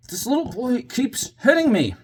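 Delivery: put-on high, slow little-girl complaint; small high tattletale voice; impatient little girl. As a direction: imitate an impatient child telling on a boy. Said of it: "she was getting really impatient".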